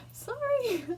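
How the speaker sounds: high, squeaky sorry through laughter; laughing apology. Real, not acted. Apologizing to family who might watch, laughing. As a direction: giggle out an embarrassed apology.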